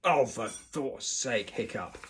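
loud, drawn-out exasperation, dropping off; exasperated. A narrator's reading. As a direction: groan the oath at a hopeless pupil. Teacher fed up with pupil.

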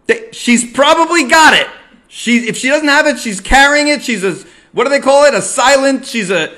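loud, high and worked-up; agitated. Retold or imitated. Son fretting his guest carries covid, loud and high. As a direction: keep the alarm going, fumbling for words.

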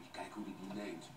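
ordinary talk

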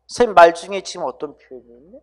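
ordinary delivery

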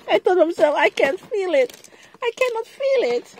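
high, loud, rattled voice; real alarm after cutting herself; shaken and upset. Real, not acted. A crafter who cut her finger tells viewers, shaken.

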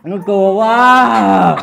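laughing through a sour mouthful; drawn-out, slow words while tasting; eating sour candy. Real, not acted.

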